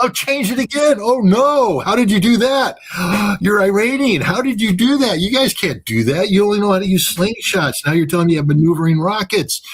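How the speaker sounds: high-pitched mock panic and disbelief; hammed-up shock at a dodging missile; flustered radar operator.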